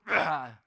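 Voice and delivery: a short, very loud exasperated roar; loud mock roar of frustration; mom's wordless yell. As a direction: blast out one furious syllable like a mom out of words. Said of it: "So she was just".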